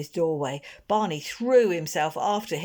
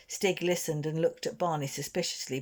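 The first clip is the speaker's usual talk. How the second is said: usual speaking voice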